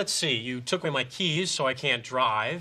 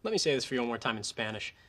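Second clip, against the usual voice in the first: ordinary delivery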